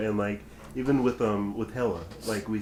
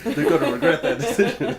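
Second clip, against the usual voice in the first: laughing through the words, louder; 5 semitones higher, 8 dB louder than usual. Real, not acted. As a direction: chuckle it out. Laughing prediction about Marvel.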